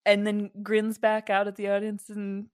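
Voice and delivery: usual speaking voice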